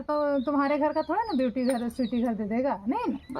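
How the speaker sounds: ordinary talk, nothing marked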